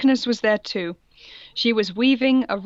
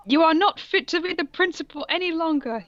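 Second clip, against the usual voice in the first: yelling; 8 semitones higher than usual. Acted. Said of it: "yelled Rumbridge".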